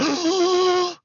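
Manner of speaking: sharp high gasp of shock. Retold or imitated. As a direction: suck in air as if stunned. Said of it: "So I'm like".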